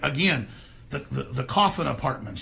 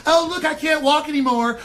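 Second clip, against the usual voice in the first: high, whiny mock voice; mocking parody, pitched high; mock fragile trendy; 10 semitones higher, 6 dB louder than usual. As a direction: play a helpless trendy person whining. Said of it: "and go".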